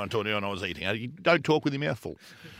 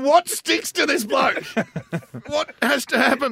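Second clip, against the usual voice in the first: disbelief; 11 semitones higher, 4 dB louder, slower than usual. Real, not acted. Amazed nothing sticks to Swanee.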